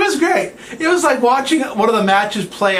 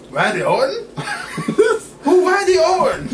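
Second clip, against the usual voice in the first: much higher, baffled and laughing; confused Japanese fan; 12 semitones higher than usual. Retold or imitated. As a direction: play a puzzled fan who never heard the name. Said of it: "Japanese and don't speak English".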